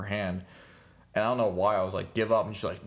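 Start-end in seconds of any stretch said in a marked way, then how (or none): 2.1-2.4 a louder push of give up; daring her to quit; mock demand. To girlfriend while wrestling, a louder teasing demand.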